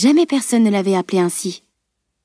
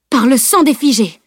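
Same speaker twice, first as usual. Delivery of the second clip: raised, urgent battle oath; exclaimed oath; 4 semitones higher, 3 dB louder than usual. A narrator's reading. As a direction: throw out an oath before rushing to help. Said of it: "jeta Maniel". (juron lancé vivement)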